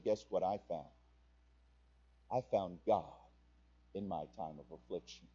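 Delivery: almost to yourself, sharing a secret joy; quiet testimony. Pretend. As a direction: drop to a hushed, intimate confession. Testimony told to a fellow believer.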